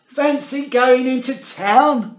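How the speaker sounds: put-on high, loud, cheery old man; grandpa. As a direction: invite the boy to town like grandpa. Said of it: "when Grandpa said".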